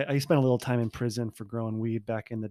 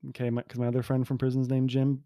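normal talking voice

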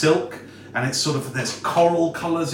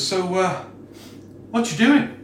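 laid-back elf voice rising higher; casual elf question; 5 semitones higher than usual. Acted. Elf leaning on the wall asks the hiding party, casually higher. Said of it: "Just goes".

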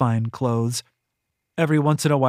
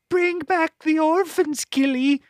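high, slow sing-song mimicry; mocking predecessor. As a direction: parody her old mistress. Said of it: "she'd say to me".

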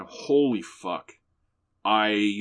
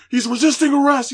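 very high, loud shout; cop shouting claim; 10 dB louder than usual. Retold or imitated. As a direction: yell it to shut her down. Cop justifies arrest.

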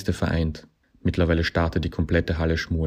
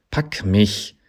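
higher, insistent command; demanding; 4 semitones higher, 4 dB louder than usual. A narrator's reading. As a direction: demand it in a raised character voice. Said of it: "forderte der Ismail-Griff ein".

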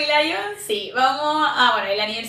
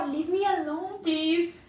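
imitating Hailey; 4 semitones higher, 7 dB quieter, much slower than usual. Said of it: "era como".

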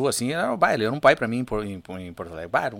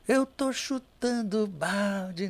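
put-on drunk voice; 7 semitones higher, 5 dB quieter, much slower than usual. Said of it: "eu imitava aquele bêbado".

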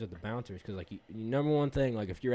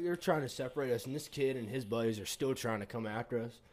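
normal talking voice

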